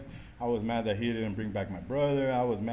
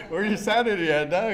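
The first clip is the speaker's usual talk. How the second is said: higher, louder, laughing through the words; laughing mock challenge; 8 semitones higher, 8 dB louder than usual. Asking a drinking buddy where his sanity is, laughing loudly. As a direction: crack up while calling him crazy.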